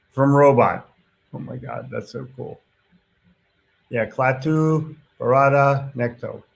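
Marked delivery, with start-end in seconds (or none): 1.3-2.6 hushed awe. Real, not acted. Host reacts to viewer comment. 4.1-6.4 slow deliberate recital. Shown as a demonstration. Repeating the movie quote.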